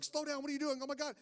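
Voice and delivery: high, rushed alarm; imitating a terrified passenger; mock panic at the speed; panicked examiner. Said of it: "He'll be like".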